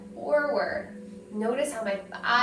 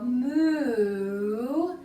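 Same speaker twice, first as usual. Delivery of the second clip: slow, drawn-out playful moo; silly moo. Shown as a demonstration. Teacher modelling a cow sound for kids, playful moo. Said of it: "Maybe you go".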